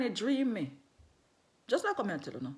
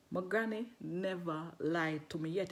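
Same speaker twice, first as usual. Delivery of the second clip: slow, deliberate and lower; slow emphasis; 4 semitones lower, 7 dB quieter, slower than usual. Retold or imitated. Woman assures the man about granny, slow emphasis. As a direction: draw out each word of the assurance.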